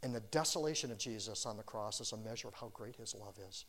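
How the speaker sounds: normal talking voice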